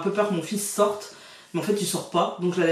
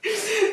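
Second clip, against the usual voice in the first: rire; 13 semitones higher than usual. Real, not acted.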